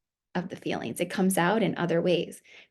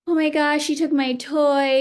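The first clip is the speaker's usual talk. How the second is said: put-on whiny crying child; 8 semitones higher, 5 dB louder than usual. Small daughter crying to her mom about a taken toy, high loud whine. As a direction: play a little girl wailing over a lost toy. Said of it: "if my daughter is crying about".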